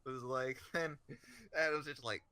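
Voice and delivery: normal talking voice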